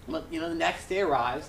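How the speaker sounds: ordinary talk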